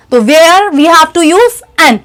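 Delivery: ordinary talk, nothing marked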